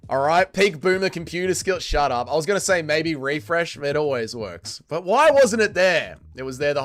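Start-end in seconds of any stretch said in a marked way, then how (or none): none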